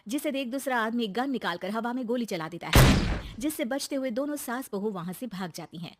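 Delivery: ordinary delivery, nothing marked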